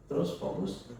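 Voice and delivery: usual speaking voice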